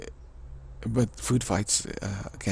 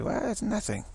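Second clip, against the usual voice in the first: higher, breezy dismissal; mock shrug; 11 semitones higher than usual. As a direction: toss it off lightly, pitched up. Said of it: "They say".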